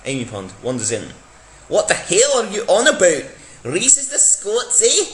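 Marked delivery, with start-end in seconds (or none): 1.7-5.0 mock Scottish indignation. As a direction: bristle like an offended Scot. Amy retorts in Scottish voice.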